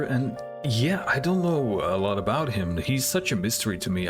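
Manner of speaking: ordinary talk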